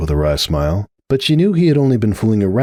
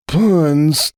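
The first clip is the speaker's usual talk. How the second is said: wheeze; 9 semitones higher than usual. Acted. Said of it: "came the next wheeze".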